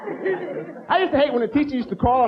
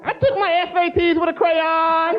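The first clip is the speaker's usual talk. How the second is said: goofy voice; 9 semitones higher, slower than usual. Acted. Goofy slow-kid voice continues.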